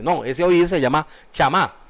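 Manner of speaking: ordinary delivery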